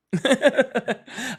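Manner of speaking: short high burst of laughter; laughing. Real, not acted. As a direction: laugh at yourself. Laughs at own blunder.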